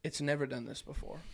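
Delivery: hushed, low deadpan; dead serious. Retold or imitated. Boat owner admitting engine trouble to his passenger, quiet and dead serious. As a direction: drop it low and grave. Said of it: "looks at me dead ass serious".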